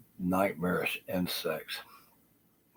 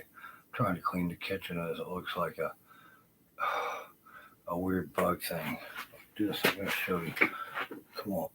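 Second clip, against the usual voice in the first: ordinary delivery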